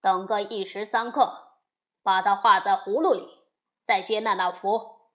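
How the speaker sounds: loud smug chief; demon king's order. A narrator's reading. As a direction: lay down the plan with authority. Gold Horn instructs.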